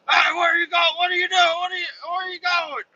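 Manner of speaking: a crowd of workers shouting at once; mock yelling. Workers yelling at the leaving driver, high frantic yell. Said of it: "people yelling at me".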